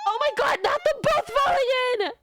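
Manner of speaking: high, loud shocked exclaim; shocked outcry. Real, not acted. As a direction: cry out in shock.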